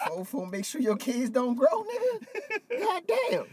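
laughing tease. Real, not acted. Teasing friend after hype rant.

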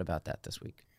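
unvoiced, soft whisper. Real, not acted. Host admitting his mistake to cohost, whispered. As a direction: whisper it like a confession.

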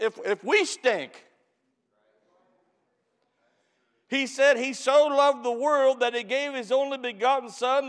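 loud, high, fervent sermon voice; full-force preaching. Acted. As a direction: preach it out to the congregation with fire. Preacher reading and exhorting.

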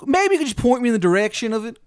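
loud, high, exaggerated Maryland twang; terrible Maryland accent. Baba Yaga greeting a newcomer, bad Maryland accent. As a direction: holler in a hammed-up Maryland accent. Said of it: "Accent's terrible".

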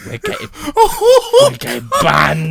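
high, loud, giggling; laughing. Real, not acted. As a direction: laugh helplessly through it. Laughing fit.

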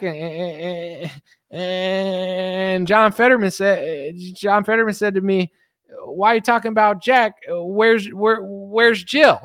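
high, mock Biden voice; squeaky doddering old president; hammed-up Biden; Biden impression.

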